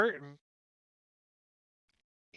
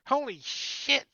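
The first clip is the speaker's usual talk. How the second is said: high, loud panicked goblin yelp; shrill alarmed goblin cry; 13 semitones higher, 9 dB louder, much slower than usual. Acted. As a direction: goblin spotting the intruders. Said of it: "He's like".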